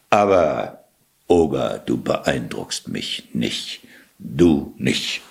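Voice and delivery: low, slow dismissal; unimpressed taunt. A narrator's reading. Cat provoking the shape-shifting ogre, unimpressed.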